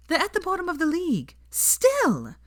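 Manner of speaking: a high mocking jab; high, teasing girl; Hermione voice.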